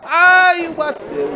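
sudden alarmed cry, high; surprised shout. Real, not acted. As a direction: yelp as the car hits. Crash seen during live race.

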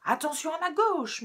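high, loud threat; threatening. Acted. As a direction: warn him with menace. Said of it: "menace Grisou".

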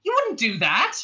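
high-pitched protest; crying out. A narrator's reading. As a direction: cry it out in disbelief. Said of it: "cried Trillian".

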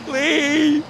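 mock plea.

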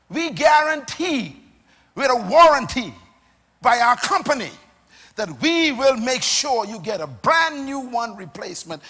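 loud company pledge, hammered phrase by phrase; forceful, loud voicing of a company; emphatic guarantee. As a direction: proclaim it in bursts like a solemn promise.

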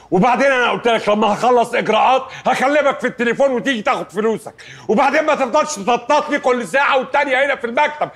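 high, insistent rebuke; angry scolding. Acted. Lawyer warns client off pestering the office, angry scolding. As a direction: lay down the law, climbing to a warning.

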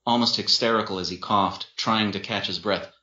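plain reading voice

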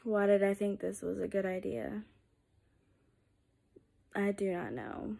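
low, very soft muttering; rueful aside. Real, not acted. Second-guessing her own idea, soft low self-questioning. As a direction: wonder aloud under your breath, low.

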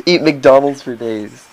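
slow, drawn-out parody American; mock American accent. Friends parodying Americans and their fast food, slow mock American accent. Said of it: "I'm gonna do American accent".

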